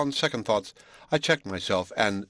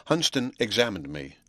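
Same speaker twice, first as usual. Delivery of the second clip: ordinary delivery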